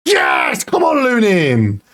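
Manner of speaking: big leaping yell of joy; high, elated yell at the keeper; excited shout. Real, not acted. Saved penalty in shootout.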